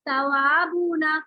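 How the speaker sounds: ordinary talk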